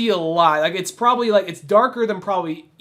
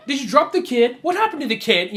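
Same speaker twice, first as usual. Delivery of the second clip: higher, prodding questions; mock pushy questioning; 6 semitones higher than usual. Kid pushing the poor mom about her child, mock needling. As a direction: imitate him needling, pitched up. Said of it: "Just keeps on pushing".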